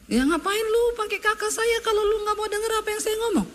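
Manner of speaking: mock scolding of a stubborn kid; scolding parent. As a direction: tell off the child who won't listen. Parent scolding disobedient child.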